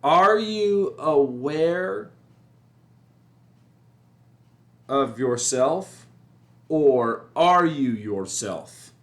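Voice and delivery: slow, weighty, drawn-out question; slow emphatic question. Shown as a demonstration. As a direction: pose it slowly as a big question for the listener. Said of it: "And the questions are".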